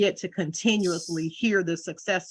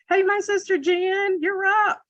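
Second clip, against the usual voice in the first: high sing-song call out; cheerful call; 12 semitones higher, 3 dB louder than usual. Real, not acted. Host calling her sister Jen up to speak, high cheerful call.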